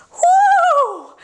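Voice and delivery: breathy high woo; excited cheer. Real, not acted. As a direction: let out a happy whoop. Cheer after the song.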